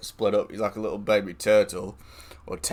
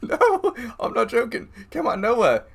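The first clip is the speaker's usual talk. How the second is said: laughing; 12 semitones higher than usual. Real, not acted. To listener, insisting it's true, still laughing. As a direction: giggle through the insistence.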